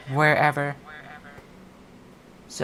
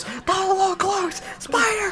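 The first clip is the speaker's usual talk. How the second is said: high squeaky scandalized cry; mock alarm; 3 dB quieter than usual. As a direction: squeal it in fake shock. Mock alarm at near slur.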